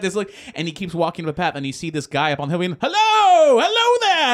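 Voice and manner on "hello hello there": high, loud hail from afar; calling out. Retold or imitated. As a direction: holler a theatrical greeting across a distance. Acting man calling from a hill.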